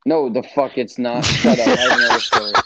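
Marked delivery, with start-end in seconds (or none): 1.2-2.5 loud. Real, not acted. Friend cuts the podcast off.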